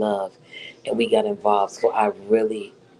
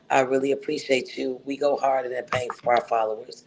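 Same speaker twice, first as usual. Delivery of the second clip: ordinary delivery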